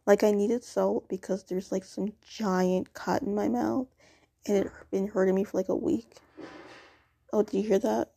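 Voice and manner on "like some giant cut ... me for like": ordinary talk, nothing marked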